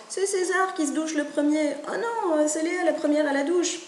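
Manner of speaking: put-on high childish bickering; squabbling chicks. Chicks argue over who showers first, high and childish. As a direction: play the kids fighting over the shower. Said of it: "ils commencent".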